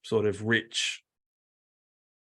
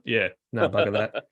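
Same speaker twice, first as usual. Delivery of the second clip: ordinary delivery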